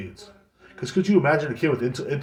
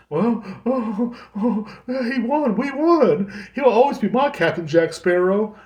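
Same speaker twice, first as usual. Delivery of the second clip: high, whiny imitation of a weepy fan; mock a blubbering superfan; sobbing fan, hammed up; mock teary fan; 9 semitones higher, slower than usual. Mimicking teary celebrity fan.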